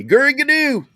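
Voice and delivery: high, playful imitation of Gurry Gnu; mock childhood friend calling Gurry Gnu; friend's nickname call. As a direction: sing out the nickname like his buddy. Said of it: "that's what he called me".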